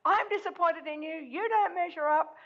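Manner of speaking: lightly voiced mother, let down by her son; mild mock disappointed mother; disapproving mother. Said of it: "his mother going".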